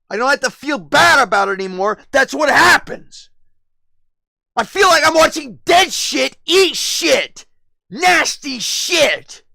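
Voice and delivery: high, loud, over-the-top shouted rant; full shout. Real, not acted. Streamer yelling his disgust at chat, high full shout. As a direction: let it all out at the top of your voice.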